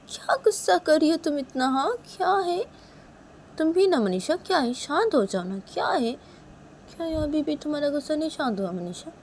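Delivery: high-pitched, put-upon complaint; exasperated pleading. Acted. Nisha urging Manisha to calm down, exasperated.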